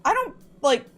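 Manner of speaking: mildly exasperated self-defence; a little worked up. Real, not acted. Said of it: "I'm getting a little worked up".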